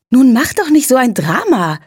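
breezy and unbothered, brushing it off; cheerful mother. A narrator's reading. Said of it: "erklärte meine Mutter gut gelaunt".

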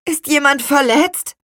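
high, loud anxious question; high-pitched child figure, concerned; concerned boy. A narrator's reading. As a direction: call out a worried question. Boy asks after a crash.

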